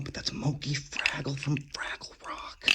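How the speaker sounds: hushed, secretive whisper; excited whisper. Real, not acted. Collector whispering to viewers about a Fraggle toy. Pointing out a toy she owns.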